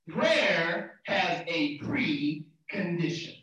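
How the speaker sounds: "Prayer has a precondition" spelt out very slowly; slow emphasis. Real, not acted. Preacher repeating first P for note-takers, slow emphasis. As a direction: stretch "precondition", parting "pre" from "condition".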